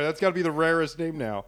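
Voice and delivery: ordinary talk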